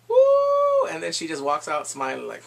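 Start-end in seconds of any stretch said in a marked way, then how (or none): 0.1-0.9 high, loud cheer; piercing celebratory woo; excited whoop. Real, not acted. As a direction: whoop like a fan at a game. Cheering Arya's revenge.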